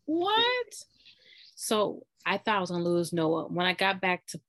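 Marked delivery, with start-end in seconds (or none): 0.1-0.7 high-pitched retold disbelief; incredulous what. Patient retelling being sent away while crying, incredulous. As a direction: let it leap up high in disbelief.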